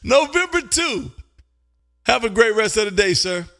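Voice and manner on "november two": high, drawn-out call sign; ringing radio call. Real, not acted. Host logs the caller's sign, ringing drawn-out call. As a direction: sing out the call sign slowly.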